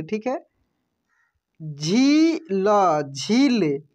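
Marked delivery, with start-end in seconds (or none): 1.6-3.0 झील stretched very slowly with a long ई; drawn out. Shown as a demonstration. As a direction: hold the vowel of झील long. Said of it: "क्या पढ़ेंगे हम लोग?". (झील को खींचकर बोलिए)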